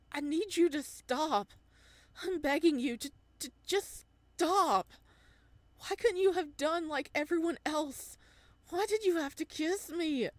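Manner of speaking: high, loud, begging her to stop; desperate pleading. Acted. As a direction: plead in anguish, voice near breaking. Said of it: "His voice cracks and fissures".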